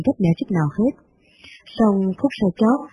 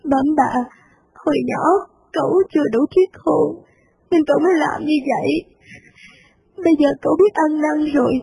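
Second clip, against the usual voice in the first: much higher, slow, weepy pleading; tearful young maid; 7 semitones higher, slower than usual. Acted. Maid pleading with her mistress for the son, near tears.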